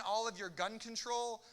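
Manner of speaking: normal talking voice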